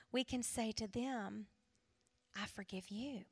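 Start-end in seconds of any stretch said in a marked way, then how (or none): 2.3-3.3 very soft, lower, tender delivery; barely voiced forgiveness; hushed forgiveness. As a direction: say it gently, almost under your breath.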